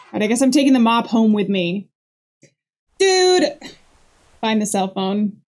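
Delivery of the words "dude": startled shout. Real, not acted. Reacting to ghost.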